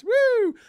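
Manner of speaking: high, loud "woo"; celebratory whoop. Real, not acted. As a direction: cheer the donation with a whoop. Cheering a super chat donation.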